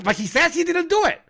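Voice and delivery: very high, squealing protest; mock helpless insistence. Mainstream media defending a denial to a doubter, squeaky mock protest. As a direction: whine it way up high, hammed-up.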